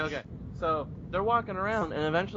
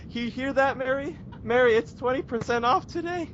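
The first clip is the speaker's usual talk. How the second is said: high, thrilled imitation of a shopper; mock near-tearful delight; overjoyed customer; 9 semitones higher than usual. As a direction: gush it to a friend, pitched way up. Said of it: "she's about to fucking cry".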